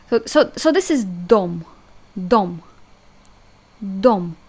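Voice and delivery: reading dom aloud; Russian dom. Shown as a demonstration. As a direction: read dom clearly. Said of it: "I'm gonna read it".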